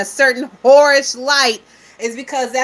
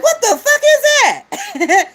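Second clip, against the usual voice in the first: high laughing what the fuck; incredulous laugh; laughing disbelief; 11 semitones higher, 3 dB louder than usual. Real, not acted. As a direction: laugh through the question.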